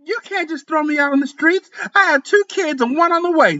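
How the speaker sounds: high, desperate voiced woman; the other woman shrieking at being thrown out. A narrator's reading. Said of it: "Zakiya shrieked".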